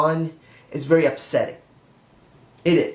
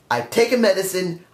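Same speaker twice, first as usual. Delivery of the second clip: ordinary talk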